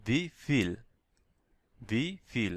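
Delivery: "we feel" as vee feel, clear f. Shown as a demonstration. Teacher shows German V pronounced F, deliberate f demo. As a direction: separate "we" and "feel", sounding v then f. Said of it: "এফ উচ্চারণ হবে".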